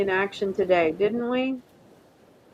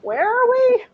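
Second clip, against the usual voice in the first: mock bewildered; 13 semitones higher, 5 dB louder than usual.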